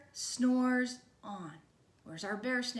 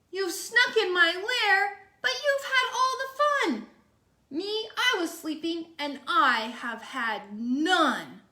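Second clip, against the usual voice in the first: loud, higher, tearful bear complaint; sad, crying bear; 5 semitones higher, 5 dB louder than usual. Acted. Bear upset at missing the party, crying loudly. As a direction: wail it out like a hurt, left-out bear. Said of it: "He's very sad".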